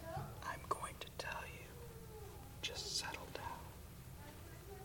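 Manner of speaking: a hushed whisper to settle the room. Retold or imitated. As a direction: whisper them down to calm. Parent calming a heated argument.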